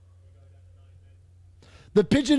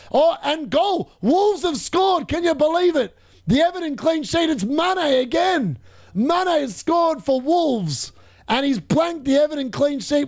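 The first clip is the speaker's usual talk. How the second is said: higher, slow, drawn-out commentator call; live goal call; 5 semitones higher, slower than usual. Acted. Fantasy football host calling Mane's goal to viewers, slow commentator call. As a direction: announce the goal like a match commentator.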